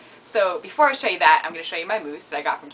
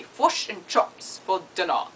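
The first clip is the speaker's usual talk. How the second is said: New Zealand vowels, fish turned to fush; New Zealand accent; slower than usual. Shown as a demonstration. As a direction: imitate New Zealand speech. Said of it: "And they say".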